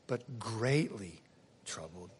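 slow, low, sinking almost to nothing; hushed emphasis. A narrator's reading. Preacher dwelling on Jesus' distress, slow and hushed. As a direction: let "troubled" drop away very soft and low.

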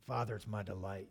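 soft, low, reverent devotion; a hushed, willing offer to his father; Jesus, tender submission. Acted. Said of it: "Can you picture Jesus saying that".

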